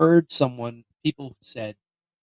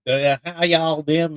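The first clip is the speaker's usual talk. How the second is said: slow country drawl; mock country accent; 3 semitones higher, slower than usual. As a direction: imitate a friendly country local's drawl. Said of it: "people were kind of more like".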